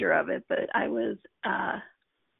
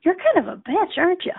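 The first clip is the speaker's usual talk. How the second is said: blurted line; 8 semitones higher, 4 dB louder than usual. Retold or imitated. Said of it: "I'm like".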